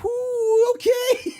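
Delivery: squeaky high whoo, then a laugh; nervous laughing. Retold or imitated. Riding along as police jam people up, high nervous laughing.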